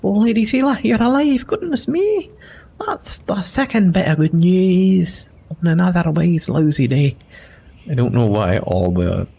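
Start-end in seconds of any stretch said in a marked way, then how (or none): 0.0-7.2 much higher, jittery little rogue; a startled small fellow relieved it's not demons; squeaky-high halfling, flustered and polite; nervous halfling voice. Acted. Halfling overjoyed.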